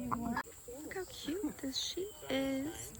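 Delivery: slow, soft, tender aside over the sheep; soft cooing. Real, not acted. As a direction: melt a little, slow and soft.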